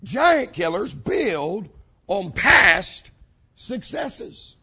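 usual speaking voice

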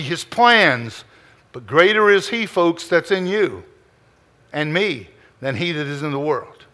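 ordinary talk, nothing marked

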